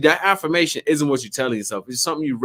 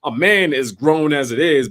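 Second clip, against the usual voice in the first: usual speaking voice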